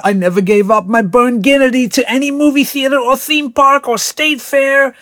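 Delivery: fervent vow. Acted. Popcorn vowing loyalty to Drew, high fervent declaration. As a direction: swear your devotion grandly.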